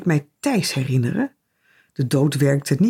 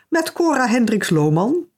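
lightly put-on genteel voice, higher; posh telephone voice; 7 semitones higher than usual. A narrator's reading. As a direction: answer with a touch of grandeur. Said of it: "Ze zette een voorname stem op". (licht voorname stem)

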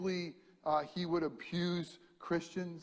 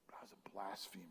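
hushed, ashamed whisper; whispered confession; 8 semitones lower, 16 dB quieter, faster than usual. Acted. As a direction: breathe the confession almost silently. Paul confessing his past sin.

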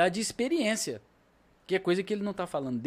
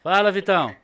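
calling out; 3 semitones higher, 7 dB louder than usual. Real, not acted. Greeting a caller on live stream.

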